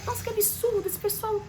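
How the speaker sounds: high, outraged nurse voice, scolding the staff; indignant nurse. Retold or imitated. Nurse complains to the patient about colleagues, mock outraged.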